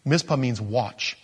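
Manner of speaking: normal talking voice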